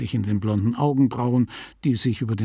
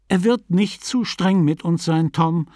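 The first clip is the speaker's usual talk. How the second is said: higher, reassuring persuasion; persuasive; 6 semitones higher than usual. A narrator's reading. Power convincing Tom about the priest, persuasive. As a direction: gently talk a friend round. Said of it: "sagte Mister Power überzeugend".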